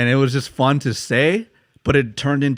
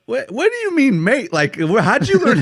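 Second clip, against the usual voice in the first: much higher, loud baffled British bloke; surprised UK guy; 12 semitones higher, 5 dB louder than usual. Retold or imitated. UK coworker at drinks, puzzled and loud. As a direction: play the confused Londoner asking how he learned. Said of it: "one guy was like".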